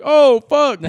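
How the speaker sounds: high, loud startled "Oh, fuck."; shocked exclamation. Retold or imitated. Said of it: "I was like".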